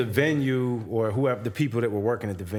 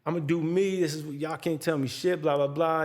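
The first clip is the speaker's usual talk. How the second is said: higher-pitched imitation of a defiant rant; retold rant; 6 semitones higher than usual. Rapper refusing to stop smoking on stage, retold rant. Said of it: "he continues his rant on side stage".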